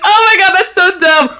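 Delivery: laughing embarrassed outburst. Retold or imitated. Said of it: "I must have been like".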